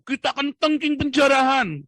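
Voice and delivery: mock preacher's high, booming declaration; Gilbert. Imitating a pastor rebuking looting, loud mock proclamation. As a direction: shout it like a preacher rebuking evil. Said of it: "Kayak Gilbert kemarin".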